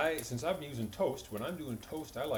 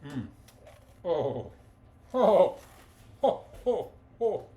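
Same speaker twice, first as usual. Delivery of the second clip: delighted moans; 5 semitones higher, 9 dB louder, much slower than usual. Real, not acted. Tasting his fried egg.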